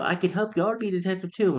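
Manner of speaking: high-pitched imitation of an eager girl. Penny offers Gadget her help, high eager imitation. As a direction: pitch it up as a keen young helper.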